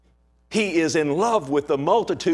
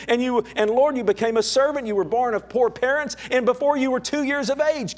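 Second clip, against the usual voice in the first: higher, impassioned preaching voice; preaching at full force; 6 semitones higher than usual. Acted. Preacher to his congregation, impassioned full-force preaching. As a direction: plead with the Lord from the pulpit, building. Preacher pleads with Lord, rising.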